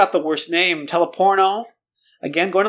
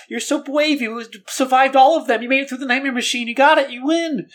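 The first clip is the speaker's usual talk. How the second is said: mock hype; 7 semitones higher than usual. Hyping listeners as brave survivors.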